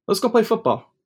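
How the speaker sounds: high excited uncle voice; eager uncle calling out, pitched high; uncle's voice. Retold or imitated.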